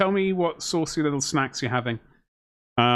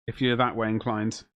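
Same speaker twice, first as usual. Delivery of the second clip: ordinary delivery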